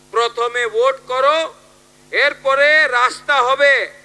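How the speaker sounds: loud, high political stump speech; rally oratory. Acted. Politician quoting rival camps to voters at a rally, loud oratory. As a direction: project each phrase to a big crowd.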